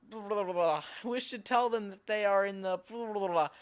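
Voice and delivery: tongue-flapping blah-blah filler; garbled blubbering mid-sentence; nonsense babbling. Real, not acted. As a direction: babble through the words you can't read. Babbles over words while reading.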